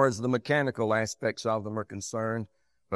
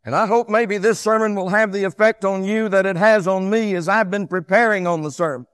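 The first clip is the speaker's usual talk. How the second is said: loud, high pulpit delivery; raised preacher's voice, driving each phrase; full-force preaching; 8 semitones higher, 10 dB louder than usual. Real, not acted. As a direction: preach it out strongly, high and emphatic.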